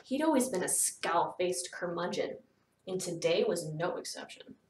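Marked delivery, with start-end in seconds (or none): none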